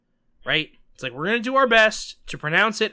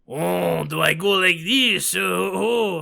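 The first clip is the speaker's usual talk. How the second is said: mock Japanese accent; slower than usual. Shown as a demonstration. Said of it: "that's way more offensive".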